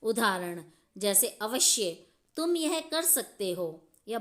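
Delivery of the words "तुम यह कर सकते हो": bright, higher cheer of approval; encouraging example line. Shown as a demonstration. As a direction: cheer the student on. Teacher voices an approving example.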